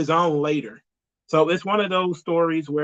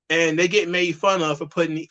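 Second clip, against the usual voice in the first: ordinary delivery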